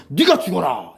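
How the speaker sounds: hammed-up death sentence; mock command. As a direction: pronounce it as a mock exclaimed order. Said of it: "이렇게 되는 거지".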